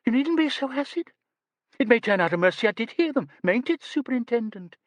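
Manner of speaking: high, fast, indignant squeak; stung protest. A narrator's reading. Miss Lee protesting to the superintendent, high and indignant. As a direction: bristle and appeal for support.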